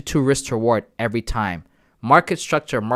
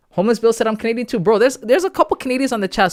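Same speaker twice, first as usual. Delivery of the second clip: high, louder hyped chat reading; excited; 10 semitones higher, 5 dB louder, faster than usual. Real, not acted. Streamer reads chat about fellow Canadians, excited and high.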